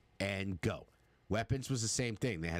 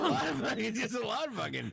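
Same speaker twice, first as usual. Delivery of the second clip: laughing; 13 semitones higher, faster than usual. Real, not acted. Laughing at Marvel cast list.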